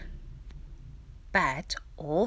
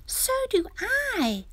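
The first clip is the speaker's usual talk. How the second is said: very high, slow little ant voice; tiny whispering ant. A narrator's reading. Tiny ant piping up after the rhino, in a tiny high voice. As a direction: squeak it slowly like a tiny ant. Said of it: "whispered the tiny ant".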